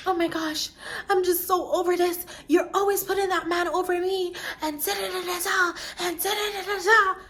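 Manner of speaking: high, whiny imitation of tearful wailing; mock hysterical crying. Friend on the phone, imitated sobbing accusation high and whiny. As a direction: mimic a friend's over-the-top crying fit, pitched high. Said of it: "She starts hysterically crying".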